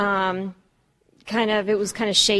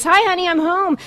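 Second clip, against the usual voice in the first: cheery sitcom dad greeting, pitched up; 8 semitones higher than usual. Retold or imitated. Mocking the TV dad who comes home with a briefcase, cheery. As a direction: play the fifties TV father walking in. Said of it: "Daddy was always coming home with the briefcase".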